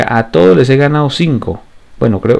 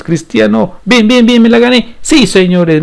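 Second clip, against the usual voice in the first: excited commentary; 10 semitones higher than usual. Real, not acted. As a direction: cheer the run on. Player breaks through defense.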